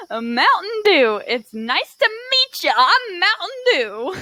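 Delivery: high, loud, drawn-out greeting; big stretched-out character hello; lively character introduction. Acted. Character introduces themself as Mountain Dew, high and exuberant.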